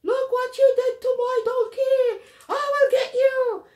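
very high, loud enraged shouting; piercing outraged yell; screaming donkey owner. Acted. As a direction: scream a furious threat at the culprit. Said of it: "donkey owner started screaming at me".

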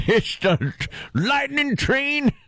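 put-on old west prospector. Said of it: "ghost town out west".

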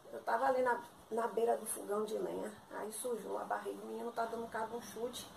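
very soft, trailing grumble; muttered complaint. Acted. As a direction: let the complaint sink into a mutter. Complaining about her dirty clothes.

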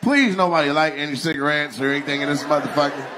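ordinary delivery

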